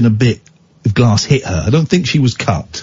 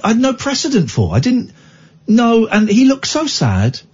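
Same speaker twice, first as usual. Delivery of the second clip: normal talking voice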